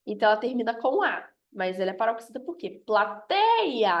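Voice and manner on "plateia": "Plateia" with TEI drawn out and raised; stress on TEI. Shown as a demonstration. Teacher showing the strong syllable, TEI stressed in Plateia. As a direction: lean long on the TEI of Plateia. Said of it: "a minha sílaba mais forte é o tei".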